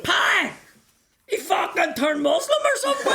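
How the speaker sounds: high, loud laughing yell; laughing mock shout. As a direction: shout it across the room through laughter. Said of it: "But Dave McComb went".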